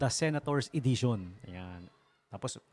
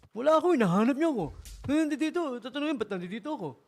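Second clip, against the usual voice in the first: put-on much higher, louder, fast whiny complaint like a kid; childish whine; 11 semitones higher, 6 dB louder, faster than usual. DJ showing how the senator sounds like a child, fast whining. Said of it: "parang bata yung ganun".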